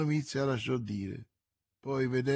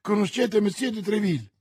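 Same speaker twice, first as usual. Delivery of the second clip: higher, louder, eager astonishment; amazed question; 8 semitones higher, 6 dB louder than usual. A narrator's reading. The novice asking Milady, amazed and eager. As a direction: ask it wide-eyed.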